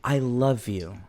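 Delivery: low, soft declaration; earnest, tender. Retold or imitated. JCVD to his hurt friend, hushed and earnest. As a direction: say it quietly and sincerely.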